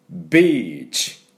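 slow ビーチ, long ee, kiss-like ch; strong beach. Shown as a demonstration. Teacher modelling ビーチ firmly. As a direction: say ビーチ slowly with a clear ch. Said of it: "これぐらいしっかり音を出してください".

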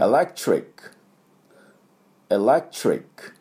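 エレクトリック spoken carefully as English "electric"; エレクトリック said clearly for students to copy; model for repetition. Shown as a demonstration. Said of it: "私の後について発音いきましょう". (お手本の「エレクトリック」)

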